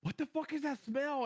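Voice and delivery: high, slow alarmed shout; alarmed outburst. Retold or imitated. Smelling burning rubber while gaming, alarmed outburst.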